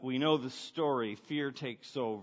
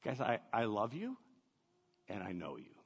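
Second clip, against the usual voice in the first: soft, gentle voice for Jesus comforting friends; Jesus tenderly reassuring his frightened disciples, soft and intimate; tender reassurance; 5 semitones lower, 7 dB quieter than usual. Retold or imitated. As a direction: speak it like a loving promise, hushed. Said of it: "It's like he's saying".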